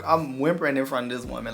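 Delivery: ordinary talk, nothing marked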